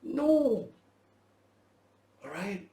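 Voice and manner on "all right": ordinary talk, nothing marked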